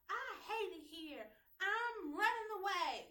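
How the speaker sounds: put-on high, slow pouting little girl; child running away. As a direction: play the sulking kid who's leaving. Child voiced in story.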